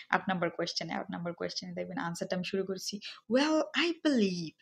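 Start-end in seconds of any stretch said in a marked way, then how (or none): 3.3-4.5 high, slow, drawn-out dramatic opener; slightly dramatic. Shown as a demonstration. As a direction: open a little dramatically, stretching it out high. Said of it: "একটু dramatically শুরু করেছি". (একটু নাটকীয়ভাবে, টেনে, উঁচু সুরে)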